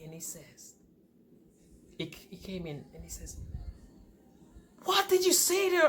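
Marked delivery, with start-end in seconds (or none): 4.8-5.9 high-pitched mock outrage; imitated angry husband. Husband confronting wife over his ex, mock angry. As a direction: mimic an upset husband's accusing question. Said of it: "a man is getting angry".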